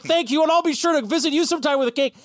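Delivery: put-on high, bright grateful girl, fast; grateful young witch thanking her neighbor, high and fast; high sweet girl voice. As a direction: voice Kiki thanking the old woman, high and quick. Kiki voiced thanking old woman.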